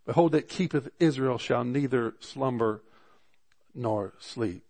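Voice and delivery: preacher reading the psalm to his church, solemn and lifted; scripture reading. Acted. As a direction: proclaim the verses like a preacher. Reads psalm aloud to congregation.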